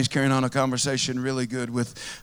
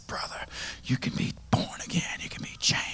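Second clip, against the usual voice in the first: hushed, tender whisper over him; soft whispered promise while praying; whispered prayer; 8 dB quieter than usual. Retold or imitated. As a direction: breathe the words gently into his ear. Praying over weeping man.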